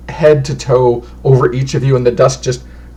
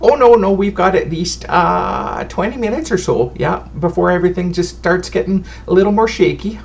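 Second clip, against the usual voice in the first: put-on higher, doting old fortune teller fretting over dust; a chatty granny clucking over dirty guests; bustling, motherly old woman voice; fussy old lady; 6 semitones higher than usual. Old lady keeps talking.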